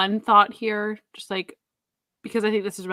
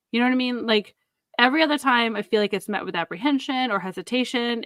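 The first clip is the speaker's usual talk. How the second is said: ordinary delivery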